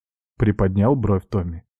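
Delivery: usual speaking voice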